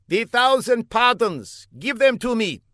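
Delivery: high, pompous put-on foreign voice; a self-important spy explaining his mistake; high comic foreigner. A narrator's reading. Older man explains to Fla, high and pompous.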